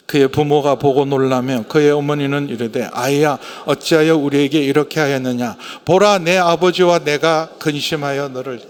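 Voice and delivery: loud, even verse reading; scripture read aloud. Real, not acted. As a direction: lead a congregation reading scripture together. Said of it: "사십팔 절 우리 함께 읽어보겠습니다". (성경 구절을 큰 소리로 봉독)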